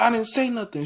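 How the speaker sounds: high-pitched mock denial; retold protest. Accused student answering the teacher, high retold protest.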